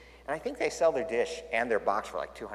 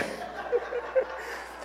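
high laugh; 4 dB quieter than usual. Real, not acted.

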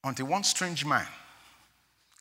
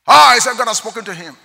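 preaching at full force; 7 semitones higher, 11 dB louder than usual. Acted.

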